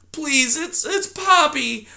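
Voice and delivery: put-on high, small, timid mouse girl; timid young mouse. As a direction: squeak it out like a frightened little mouse. Poppy voiced in story.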